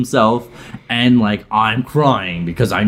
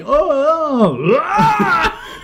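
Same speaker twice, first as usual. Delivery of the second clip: high crying "oh"s that break into a laughing yell; mock sob flipping into a shout; sad turning mad; much slower than usual. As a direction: sob then snap into fury, played for laughs. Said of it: "I'm flipping between, like, mad and sad".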